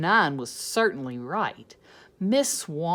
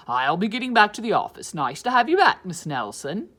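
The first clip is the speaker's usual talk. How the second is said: fast, louder principal voice; 8 dB louder, faster than usual. Acted. Principal leaving the room, fast and louder. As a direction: hurry out like a busy principal.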